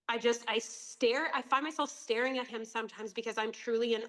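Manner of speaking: usual speaking voice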